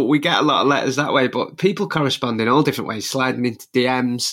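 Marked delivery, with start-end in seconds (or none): none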